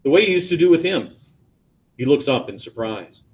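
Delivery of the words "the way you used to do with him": quicker, higher-pitched remark; change of key. A narrator's reading. Son brings up his brother to Dad, key changed. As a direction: shift up into a new key. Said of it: "A key change".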